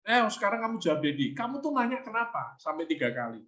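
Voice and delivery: a father playfully pressing his son; pressing dad. Retold or imitated. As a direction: demand an answer, half teasing. Dad pressing child for answer.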